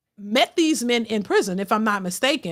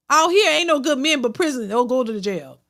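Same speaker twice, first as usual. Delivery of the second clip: normal talking voice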